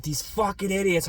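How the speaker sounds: high muttered insult at the team; retold cursing. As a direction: curse them out into the pillow. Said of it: "cursing the Browns".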